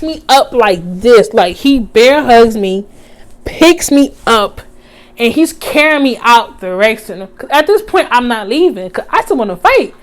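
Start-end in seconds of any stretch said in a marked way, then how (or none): none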